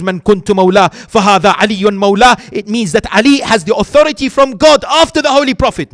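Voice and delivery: loud, rising sermon climax; preaching at full force. Acted. Preacher drives his proof home to the congregation, loud and fervent.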